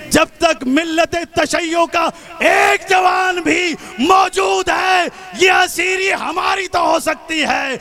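ringing, forceful rally oratory; impassioned preaching. Real, not acted. Vowing to protect the women of the community, impassioned shout. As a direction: build a defiant vow, drawing out the key words.

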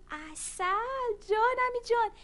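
stretched-out, then high loud joy; dreamy delight. Acted. Ant daydreaming about honey, delighted. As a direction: savour the word then burst with glee.

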